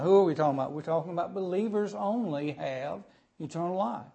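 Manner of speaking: normal talking voice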